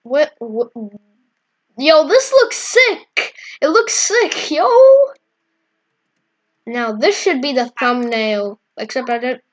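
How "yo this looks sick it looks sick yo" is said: hyped, high and loud; excited. Real, not acted. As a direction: gush over the cool view. Excited about the view.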